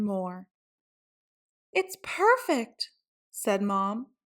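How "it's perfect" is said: delighted mom. A narrator's reading.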